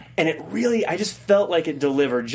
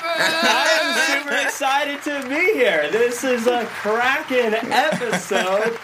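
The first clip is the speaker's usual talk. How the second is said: excited; 5 semitones higher than usual. Acted. Host hypes the show.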